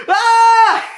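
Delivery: high, loud startled yell laughed through; shout. Retold or imitated. Luffy as his body blows apart, loud high yelp. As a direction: belt out a cartoon yelp. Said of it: "Luffy's just like".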